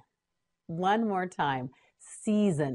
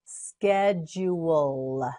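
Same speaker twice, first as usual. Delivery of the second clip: "Schedule" slowly as sked, jool; syllable by syllable; much slower than usual. Shown as a demonstration. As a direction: stretch each syllable of "Schedule". Teacher models word slowly.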